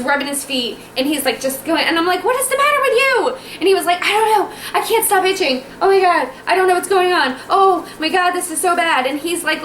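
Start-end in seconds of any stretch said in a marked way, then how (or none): 2.2-3.4 retold outburst, pitched way up; hammed-up high, exasperated wail; exasperated demand. Acted quarrel with itching husband. 4.0-9.1 high, agitated imitation of husband; frantic itching husband. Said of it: "literally looked like a meth addict".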